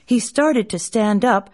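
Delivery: ordinary delivery, nothing marked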